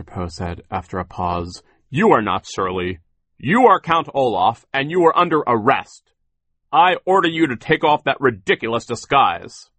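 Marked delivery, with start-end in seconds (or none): 1.9-9.7 loud, high-pitched commanding declaration; indignant arrest. A narrator's reading. Mister Poe orders Count Olaf to drop the disguise, loud and commanding. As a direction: order the villain firmly, voice raised.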